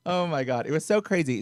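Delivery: normal talking voice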